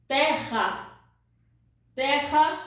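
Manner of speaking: terra with the double R said like a throaty H, ehha; guttural R. Shown as a demonstration. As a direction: breathe the rr as a rasping H in terra. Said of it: "This double R sounds like hat".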